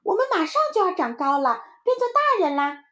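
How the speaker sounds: put-on very high, loud kid; excited little child. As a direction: announce big news to mum. Excited child announces to mother. (小孩兴奋地高声宣布)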